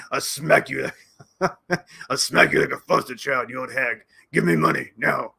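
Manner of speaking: lightly put-on voice, hammed-up threat; mock Zelensky threat. Mock Zelensky threatening Yellen, lightly voiced. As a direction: threaten her in a jokey caricature voice. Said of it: "as he would say".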